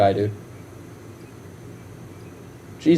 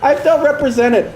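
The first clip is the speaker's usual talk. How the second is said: much higher, gushing mock voice, slowing at the end; mocking fan; 10 semitones higher than usual. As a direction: ham up a fawning fan. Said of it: "I'm not one of those guys".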